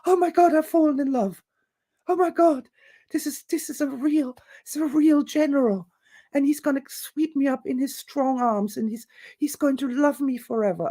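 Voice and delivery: high-pitched swooning lady, hammed up; mock giddy romantic rapture, ending in a whoop; breathless infatuation. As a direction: gush like someone suddenly madly in love. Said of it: "all of a sudden this entity explodes".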